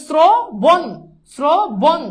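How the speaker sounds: "শ্রবণ" slowly as sro, bon, with a held o; loud, split "শ্রবণ" into two drawn syllables; ও-কার উচ্চারণ. Shown as a demonstration. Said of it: "ওকার কিন্তু উচ্চারিত হচ্ছে". ("শ্রবণ" টেনে, স্রো-বোন ভেঙে)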